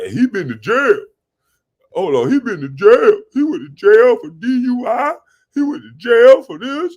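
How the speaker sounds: high, loud, incredulous; excited disbelief. Real, not acted. Streamer reacting to a troll's jail claim, loud incredulous repeat. Laughing disbelief at viewer comment.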